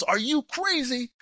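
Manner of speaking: high, drawn-out mock disbelief; incredulous. Officer to his limping teammate, imitated incredulous outrage. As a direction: stretch it out high, appalled.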